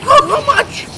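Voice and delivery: high, loud shocked stammer; can't believe the price he heard; shocked disbelief. Acted. Shocked at tiny fee.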